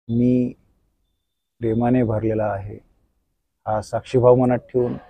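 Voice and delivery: soft, slow guided meditation voice; soft spa whisper. Acted. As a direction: lull them through a gentle body scan. Leads body-scan relaxation meditation.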